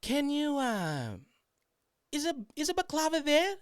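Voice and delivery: very high, halting put-on voice; imitated coworker. Coworker asking her son on the phone, high mock voice. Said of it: "and she goes".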